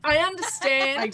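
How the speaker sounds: put-on loud Australian-accented captain. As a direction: say it loudly with an Australian twang. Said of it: "the Australian keeps getting Australian accents".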